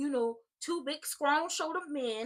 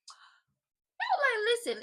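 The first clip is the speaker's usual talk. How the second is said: sudden high-pitched squeal; 11 semitones higher, 5 dB louder, much slower than usual. Real, not acted. Vlogger gushing about next week's match, high squeal.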